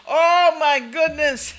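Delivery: breaking into a high laugh on the words; laughing oh my goodness. Real, not acted. Host laughing at her own joke, high laughing exclaim. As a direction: exclaim through real laughter.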